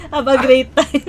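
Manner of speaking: drawn-out mimicked farewell with a laugh; laughing imitation. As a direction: quote the friend's teasing goodbye slowly, amused. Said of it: "tinatawanan mo".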